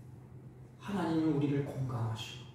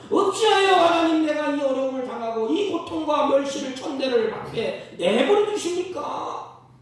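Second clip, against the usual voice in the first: higher and louder, slowing lament at the end; loud complaint; 9 semitones higher, 7 dB louder than usual. Retold or imitated. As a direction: cry out why it is happening. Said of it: "환경을 지켜보면서 불평해요".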